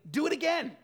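high, eager plea to the lady; freed man pushing her to heal his buddy; excited demand. Retold or imitated. Freed addict urging the missionary, high and eager.

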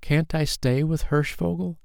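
put-on high child's begging question; small, high, imploring voice; pleading. A narrator's reading. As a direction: plead like a little boy. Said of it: "he pleaded".

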